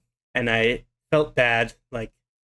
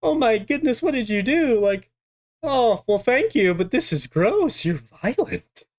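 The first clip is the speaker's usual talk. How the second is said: much higher, aghast mock older lady; shocked sister; 9 semitones higher than usual. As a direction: play the appalled kind lady. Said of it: "she's like".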